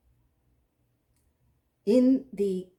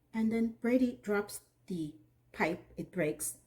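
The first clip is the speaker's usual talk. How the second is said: usual speaking voice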